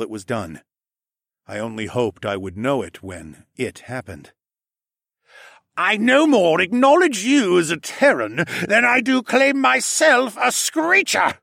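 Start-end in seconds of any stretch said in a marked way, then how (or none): 5.8-11.4 loud, high, spat with contempt; spitting venom. A narrator's reading. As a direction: spit the words out. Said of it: "Hess spat".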